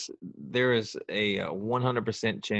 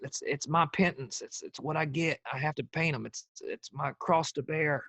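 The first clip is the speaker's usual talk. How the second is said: higher, softened presidential impersonation; George W. Bush; 4 semitones higher than usual. Acted. Ex-president explaining his painting to his wife, Bush impression. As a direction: play Bush pleading his case haltingly. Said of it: "as Bush".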